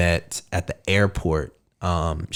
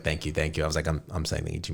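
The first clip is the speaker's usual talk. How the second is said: quiet; 6 semitones lower, 11 dB quieter, faster than usual. Retold or imitated. Retelling repeated texted thanks.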